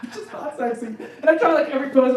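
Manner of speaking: ordinary talk, nothing marked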